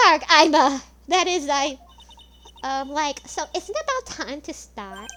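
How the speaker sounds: loud, hammed-up valley girl, all likes and ums; annoying American girl voice.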